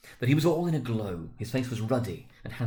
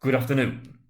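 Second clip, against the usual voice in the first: curt dismissal; 3 semitones higher, 6 dB louder than usual. A narrator's reading. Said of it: "said Scrooge".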